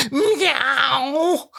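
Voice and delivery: cat imitation meow. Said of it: "I can do a cat perfectly".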